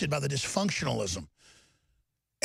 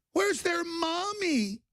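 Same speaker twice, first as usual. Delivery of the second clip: very high, slow put-on whine; hammed-up sob-story voice; mock pitying wail; 11 semitones higher, much slower than usual. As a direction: stretch it out high, mocking their concern. Repeats the mocking pity.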